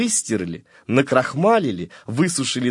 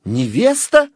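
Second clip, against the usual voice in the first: higher, louder incredulous "Невеста?"; shocked exclamation; 8 semitones higher, 6 dB louder, slower than usual. A narrator's reading. The collar reacts to the comb's news, higher and louder. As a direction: gasp in disbelief. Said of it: "воскликнул воротничок".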